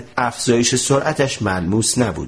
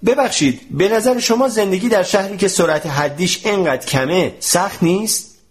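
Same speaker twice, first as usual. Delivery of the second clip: lightly acted raised-voice question; calling out; 7 semitones higher than usual. A narrator's reading. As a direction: call a question across to someone, pitched up. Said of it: "فریاد زد".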